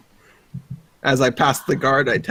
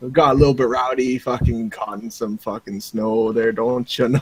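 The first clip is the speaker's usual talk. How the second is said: hammed-up Canadian accent, rambling along. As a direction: play a chatty Canadian with a thick accent. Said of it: "I guess I have to fucking home my, my Canadian".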